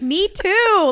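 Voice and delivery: high, loud mock excited chime-in; exaggerated high-pitched "same here!"; eager agreement. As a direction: blurt it out in amazed agreement, imitating. Both chiming in with same ache.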